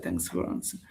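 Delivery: a breathy, barely voiced thank-you; mock whispered thanks. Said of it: "Something like".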